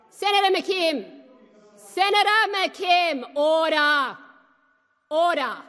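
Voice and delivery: high loud shout for order. Real, not acted. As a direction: yell over the uproar. Chair shouting over the uproar.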